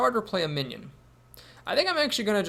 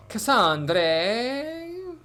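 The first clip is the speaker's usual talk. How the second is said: loud, higher mock-Italian call; Italian accent; 7 semitones higher, 4 dB louder than usual. Player blurts out a card game call, playful Italian accent. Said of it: "said that in an Italian accent".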